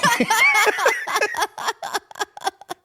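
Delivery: high-pitched burst of laughter; big laugh. Real, not acted. Laughing at own Parmesan joke, high-pitched.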